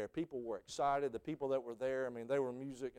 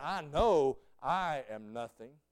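slow and a bit louder, earnest; slow humble confession; 6 dB louder, slower than usual. Pretend. Preacher imagining confessing before the throne, slow and earnest. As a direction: admit it with solemn conviction. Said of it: "I'll say".

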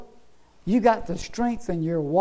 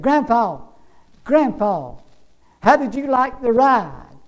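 lightly acted eager grandchildren; mildly hammed-up excited question; children calling out; 5 semitones higher, 6 dB louder, slower than usual. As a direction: call out to grandpa, a little raised.

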